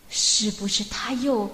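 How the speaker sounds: plain reading voice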